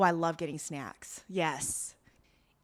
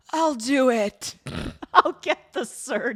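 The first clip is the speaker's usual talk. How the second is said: mock resolve; 4 semitones higher, 11 dB louder than usual. Grace hugging the sobbing Hyunwoo, loud determined vow. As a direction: declare it like a firm decision. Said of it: "she's like".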